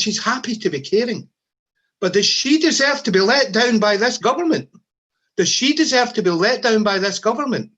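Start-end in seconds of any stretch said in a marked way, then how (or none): 2.0-7.7 louder and higher, hammering the rhetorical question; rousing loud question. Real, not acted. Union leader demanding justice from the government, rousing and loud. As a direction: raise the voice to rouse the hall. Rally speech, rhetorical climax.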